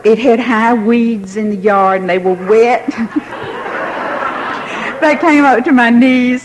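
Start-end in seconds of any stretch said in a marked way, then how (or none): 2.9-5.0 silent wheezing laugh; breathless giggle. Real, not acted. Teller recalling soaked knees, wheezing with laughter. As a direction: laugh under your breath at yourself.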